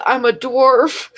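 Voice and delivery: real laugh breaking through the words, slow and high; barely holding in a laugh as she says it; slow words, cracking up. Real, not acted. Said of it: "trying so hard not to laugh".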